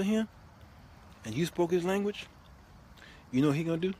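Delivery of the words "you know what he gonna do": normal talking voice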